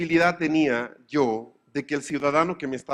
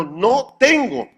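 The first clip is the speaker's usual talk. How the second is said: slow, higher, louder "no tengo"; louder curt refusal; 6 semitones higher, 7 dB louder, much slower than usual. Retold or imitated. Butcher turning the customer away, curt and louder.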